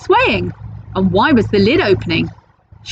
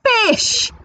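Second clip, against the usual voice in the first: high pirate voice; 14 semitones higher than usual. Acted. Pirate chief answers troll.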